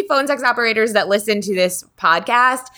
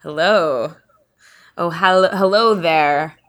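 slow drawn-out hello; mock seductive greeting; weird sultry hello; 3 semitones lower, slower than usual. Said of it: "you just have me acting really weird".

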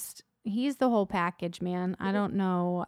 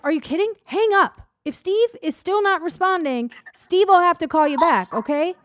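louder and higher, fed-up scolding; exasperated rant; 5 semitones higher, 7 dB louder than usual. Retold or imitated. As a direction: scold your husband for talking to a dead line. Retold rant at husband on phone.

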